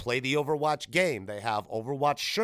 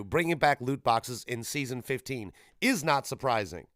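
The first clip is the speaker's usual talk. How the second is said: usual speaking voice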